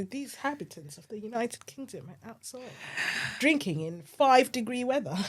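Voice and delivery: hushed nature documentary narrator; Attenborough. Retold or imitated. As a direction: murmur it like a wildlife film voiceover. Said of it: "Attenborough needs to be outside of the, of the pub and go".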